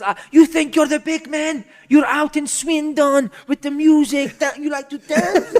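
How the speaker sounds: loud, high, slow mock rant; hammed-up raging boss, words dragged out; manager gone mental. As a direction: imitate a berserk manager taunting his players. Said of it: "he's going mental, man".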